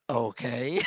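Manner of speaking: low, soft, patronizing okay. Retold or imitated. Preacher imitates a receptionist answering him in a low, soft, patronizing way. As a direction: humour the crazy guest. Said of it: "this patronizing voice said".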